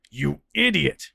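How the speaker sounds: slow, loud furious scold. Acted. Cyril scolding Fran for the bite, furious. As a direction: spit it out at him, enraged. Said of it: "Cyril was furious".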